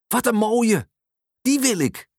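put-on high, thrilled little boy; enthusiastic. A narrator's reading. Boy wanting the yellow crane from mum, enthusiastic. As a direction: exclaim eagerly, pitched right up. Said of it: "roept Leroy enthousiast".

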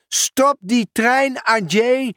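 high, slow call to stop the train; x0.6 drawn-out shout down the platform. Acted. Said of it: "riep Pieter Post". (hoog en langzaam roepen naar Adjé)

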